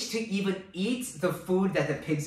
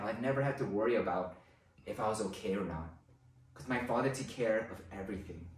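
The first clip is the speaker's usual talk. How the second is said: much lower and softer, reflective murmur; inner musing; 9 semitones lower, 7 dB quieter than usual. Retold or imitated. Starving son remembering his father's care, quietly reflective. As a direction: think it through quietly to yourself. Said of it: "he's probably thinking about".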